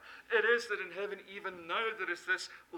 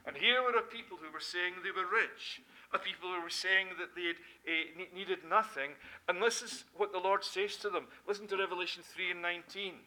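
ordinary delivery

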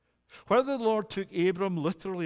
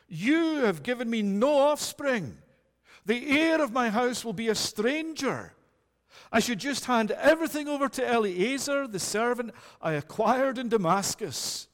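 higher and louder lament; complaining Abram; 5 semitones higher, 3 dB louder than usual. Acted. Abram laments his childlessness to the Lord, higher. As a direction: press the complaint to God. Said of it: "as he speaks to the Lord".

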